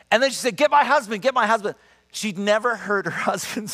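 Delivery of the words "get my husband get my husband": higher, eager repeated call; urgent excitement. Retold or imitated. Healed woman eagerly calls for her husband, higher. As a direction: she can't wait for him to come.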